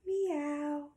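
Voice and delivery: high, gliding meow; cat meow. Acted. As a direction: imitate a cat with a long, high mew. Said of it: "A cat says".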